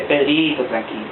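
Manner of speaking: calm Jesus. Retold or imitated. Jesus calming an eager Peter, low and soft. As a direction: settle him down quietly.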